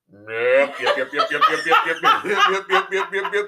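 rapid chant climbing steadily in pitch; silly puppet yipping. Pretend. Host slipping back into ventriloquism, silly puppet yips. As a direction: yip it over and over, rising like an excited puppet.

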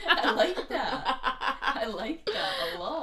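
slow words broken by laughter; laughing. Real, not acted. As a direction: laugh all the way through it.

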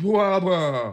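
high, loud desperate plea for water; parched croak. Pretend. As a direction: gasp it out like lost in a desert. Pretending to wake up parched.

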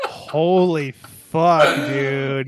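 slow, loud laughing exclamation; real laughter through the words; laughing disbelief. Real, not acted. Laughing at the story.

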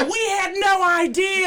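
high, loud mock amazement; amazed. Store clerks to the comic they know, mock amazed. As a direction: exclaim it like an astonished shop clerk.

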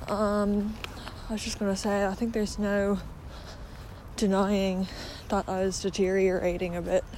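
talking around a retainer; slightly lisped, retainer in mouth; wearing a retainer. Real, not acted. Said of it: "that I have my retainer in".

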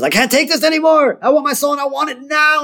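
put-on upset, high-pitched kid voice; mock outburst, pitched far up; Bart. Bart demanding his soul back, high upset wail. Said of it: "runs out of the restaurant upset".

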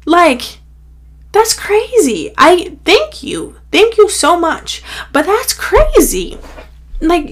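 high, bubbly disbelief at her listeners; genuinely touched, voice lifting high; overwhelmed gushing. Real, not acted. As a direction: gush with real amazement and thanks.

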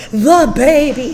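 put-on The baby said slow and loud; stress on baby. Shown as a demonstration. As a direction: lean hard on The baby.

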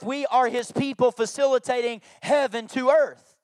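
loud, fervent preaching build. Acted. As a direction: preach it out with conviction. Preaching at full force.

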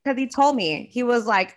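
usual speaking voice

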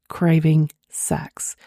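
whispering. Real, not acted. Sultry aside mid-talk.

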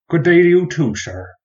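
lightly voiced country farmer bidding good day; polite farmer. A narrator's reading. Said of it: "he replied politely".

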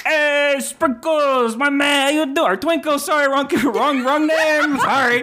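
high, eager hustler voice; squirrel dealer. Acted. Tracksuit dealer greeting the unicorn in a high hustler voice. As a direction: greet him like a sleazy pal. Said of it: "and he's like".

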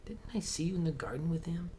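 unvoiced, secretive challenge; whispered. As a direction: whisper the question like a suspicion. Whispered accusation.

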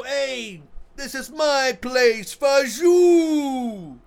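high, loud shout with drawn-out words; shouted punchline. Acted. Comedian delivering his bar joke to the drummer, shouted punchline. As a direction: belt it out slowly, stretching the last word.

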